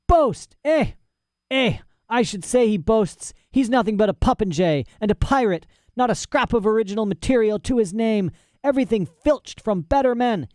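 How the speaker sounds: put-on high, scornful old scholar's rant; outraged mad professor. Professor scoffs at rival's boasting, outraged. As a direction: spit out contempt in a high voice.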